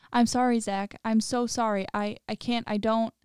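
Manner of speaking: plain reading voice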